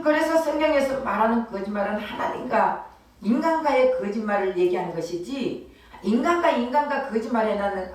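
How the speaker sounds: ordinary talk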